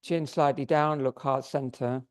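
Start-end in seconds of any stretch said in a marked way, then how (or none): none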